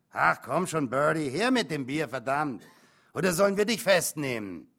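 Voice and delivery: loud, pushy drunken badgering; boozy bullying holler; drunken demand. A narrator's reading. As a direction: badger the barmaid loudly. Drunken cop demands beer, threatens.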